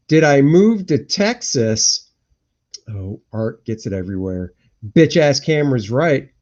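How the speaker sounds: usual speaking voice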